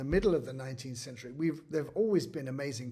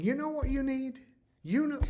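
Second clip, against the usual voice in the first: high-pitched imagined pushy letter-writer; put-on, high, presumptuous suggestion; mock sales pitch; 10 semitones higher than usual. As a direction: pitch it way up, as if pestering an orchestra. Mock pitch to symphony orchestras.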